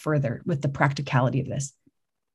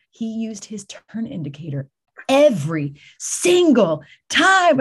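rising to a louder, higher, slow punchline; mock-solemn praise; 5 semitones higher, 5 dB louder, slower than usual. Husband reporting a driver's good signaling to the office, slow loud mock awe. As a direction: savor every word like it's heroic.

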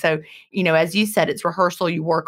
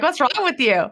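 mock demanding question; 8 semitones higher than usual. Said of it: "And they're like".

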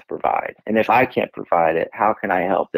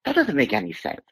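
mock wife; 11 semitones higher, 4 dB quieter than usual. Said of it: "She's like".

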